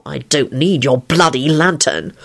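understated low snarl. A narrator's reading. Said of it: "he snarled".

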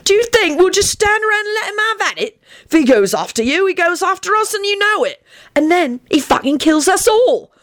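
high, indignant rant; heated, high-pitched argument; bellowed. A narrator's reading. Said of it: "Sirius bellowed".

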